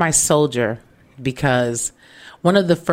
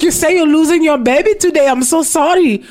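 mock concerned tow man; 12 semitones higher, 7 dB louder than usual. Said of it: "He's like".

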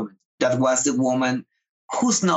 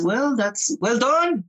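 slow, higher, gently approving; teacher's praise; 8 semitones higher, slower than usual. Retold or imitated. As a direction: hand out the praise slowly, like an elder.

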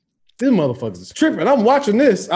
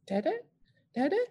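put-on high, tiny, hushed kid voice; soft toddler calls; 10 semitones higher, 10 dB quieter than usual. Toddler first calling for sleeping dad, softly. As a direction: call quietly like a waking toddler.

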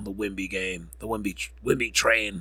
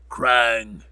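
ordinary talk

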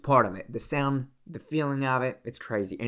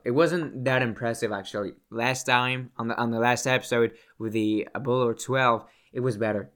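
ordinary talk

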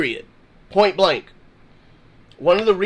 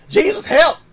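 higher, louder cry for help; acted urgent plea; 7 semitones higher, 5 dB louder than usual. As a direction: call out a short, direct prayer. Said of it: "they got straight to the point".

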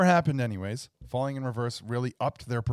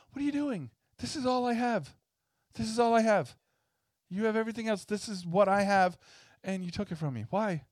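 higher, pleading guy who lost his controller; mock outrage, begging to get his controller back; upset gamer imitation; 9 semitones higher than usual. Guy whose girlfriend took his controller, higher and pleading. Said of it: "He's like".

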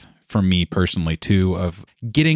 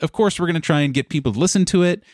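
much higher, animated rush; excited; 8 semitones higher than usual. Real, not acted. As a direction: tell it pitched up and fast, half laughing at yourself. Excited, self-mocking recollection.

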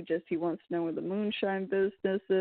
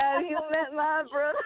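higher, thrilled echo of the line; excited movie quote; 7 semitones higher than usual. Retold or imitated. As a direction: repeat the line like a fan. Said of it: "repeats friend's quote".

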